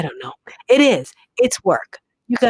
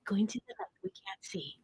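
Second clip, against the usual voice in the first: hushed whisper fading almost to nothing; playful whisper; 19 dB quieter, faster than usual. Pretend. Host urging someone into the light, whispering. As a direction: whisper it like a faint beckoning voice.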